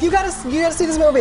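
fast, high rave; excited gush. Retold or imitated. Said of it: "that I'm like".

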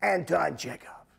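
slow, gravelly voice in the head; drawn-out gravelly impersonation; Eli Wallach. Retold or imitated. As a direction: rasp the name out like an old actor. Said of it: "that little gravelly voice in my head saying".

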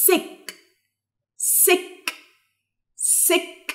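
"Sick" with held s and separately released k; dictation word. Shown as a demonstration. Teacher dictating for listen and complete, soft careful word. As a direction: sound out "Sick", soft and crisp. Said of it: "هنسمع ونكمل".

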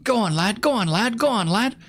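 lightly imitating British cheer; a hint of British accent; mock British repeat. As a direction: echo the clip in a British voice. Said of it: "repeating the clip line".

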